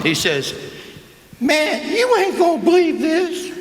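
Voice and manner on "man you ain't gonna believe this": very high, laughing put-on voice; high-pitched giggly Willie voice, much higher; Willie Mays impression. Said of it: "Here's the way Willie is".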